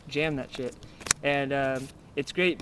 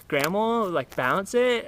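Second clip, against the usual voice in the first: slow mock call; 6 semitones higher, much slower than usual. Player bouncing card in mock style.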